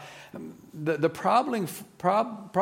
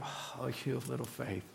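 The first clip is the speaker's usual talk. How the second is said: much softer, gentle "Oh, you have little faith"; tender Jesus; 4 semitones lower, 12 dB quieter than usual. Retold or imitated. Preacher voices a caring Jesus to worried listeners, soft and tender. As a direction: say it like a loving reassurance. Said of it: "almost saying, I've got so much more for you".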